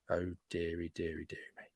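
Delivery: breathy mock-sorrowful whisper; whispered dismay. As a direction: whisper it like a disappointed granny. Mock despair over commenter.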